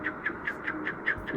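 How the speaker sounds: hushed, voiceless repeated "choo"; imitated choo sound. Patient imitating what he saw overhead, hushed choo noises. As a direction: puff out soft little choo-choo noises. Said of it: "I just seen him going".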